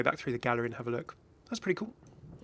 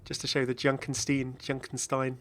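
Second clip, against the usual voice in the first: normal talking voice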